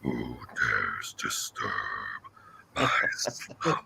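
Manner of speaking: slow, deep guttural growl; deep guttural villain voice. Retold or imitated. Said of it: "the deep guttural voice".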